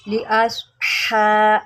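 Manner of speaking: लिअस्हा- with ha from the throat, slow. Shown as a demonstration. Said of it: "इसको आप हलक से निकालेंगे".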